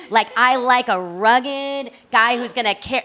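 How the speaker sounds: usual speaking voice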